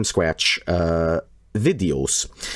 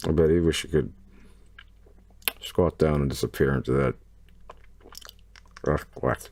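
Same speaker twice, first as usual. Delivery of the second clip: low, much softer mutter; lower, softer snarky aside; 8 semitones lower, 6 dB quieter than usual. Real, not acted. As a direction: say it under your breath. Muttered joke under breath.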